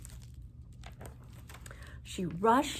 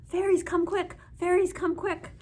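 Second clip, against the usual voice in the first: high, loud shout; 10 semitones higher, 4 dB louder than usual. A narrator's reading. Wise woman showing how to shout for the fairies. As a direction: call the fairies urgently.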